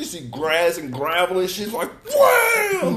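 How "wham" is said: high, loud crash sound effect; big high-pitched wham; imitated wham. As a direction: shout the impact like a cartoon hit. Imitates wheel hitting gravel.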